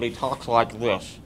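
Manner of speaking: chubby jowls, stuffed-mouth puppet voice; muffled fat-headed character; Bubba. Shown as a demonstration. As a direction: talk as if paper is stuck in your mouth. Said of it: "like he's got a piece of paper stuck in his mouth".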